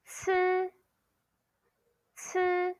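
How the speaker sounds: "ci" as an aspirated ts on a high level tone; pronunciation model. Shown as a demonstration. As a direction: hold "ci" high and even after a sharp ts. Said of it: "Next one you say".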